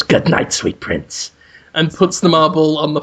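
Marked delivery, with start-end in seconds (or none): 0.0-1.3 tender farewell whisper. Acted. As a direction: breathe it like a grieving lover. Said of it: "And he just goes".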